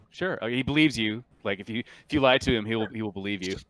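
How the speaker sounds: normal talking voice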